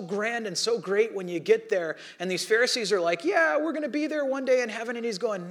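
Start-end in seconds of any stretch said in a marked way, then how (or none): 3.2-5.0 higher, breezy self-assured voice; smug confident Pharisee. Retold or imitated. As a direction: toss it off like you're already in.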